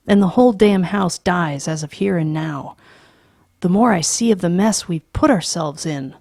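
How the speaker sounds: loud, hard tirade; angry father. Acted. Father rails at his family about the house, loud and angry. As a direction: rail against the machines.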